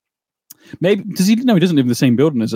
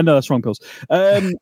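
ordinary delivery

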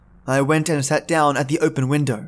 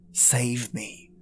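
softer, dying-away save me; fading plea; 3 semitones lower, 9 dB quieter than usual. Acted. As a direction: let the plea sink to a near-whisper of despair. Plea fading after prayer.

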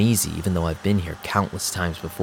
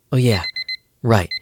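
ordinary talk, nothing marked

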